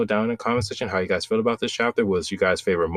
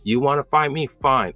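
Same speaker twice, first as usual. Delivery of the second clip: half-yelled retort with raised pitch; retold yell; 7 semitones higher than usual. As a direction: act out a fed-up challenge, pitched up. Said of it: "as Tokyo's yell".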